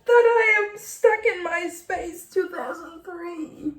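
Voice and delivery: high-pitched, upset wail of self-mockery; emotional, tearful. Real, not acted. Writer confessing to viewers while crying, high tearful voice. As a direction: push it out high through the tears. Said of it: "it's making me emotional".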